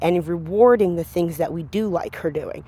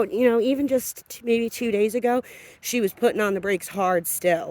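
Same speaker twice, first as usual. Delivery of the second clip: ordinary talk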